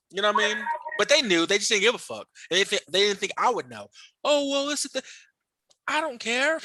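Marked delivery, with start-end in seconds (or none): 4.2-6.5 high-pitched mocking imitation; dismissive coworker. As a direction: mimic their careless excuse way up high. Mimicking coworkers' dismissive excuse.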